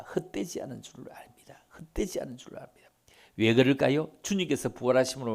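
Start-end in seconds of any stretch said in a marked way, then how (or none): 0.0-1.6 soft breathy whisper of the verse. Acted. 1.8-2.9 fast whispered aside; quick whisper. Acted.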